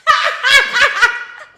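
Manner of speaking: loud shrieking laugh. Real, not acted. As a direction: burst out laughing. Laughs at comment.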